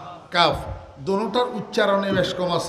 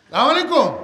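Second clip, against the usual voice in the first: higher, louder, showy আসসালামু আলাইকুম; mock a politician's loud greeting; hammed-up politician's salam; mock political greeting; 4 semitones higher, 5 dB louder, faster than usual. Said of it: "political সালাম".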